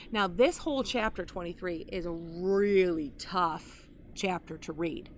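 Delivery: usual speaking voice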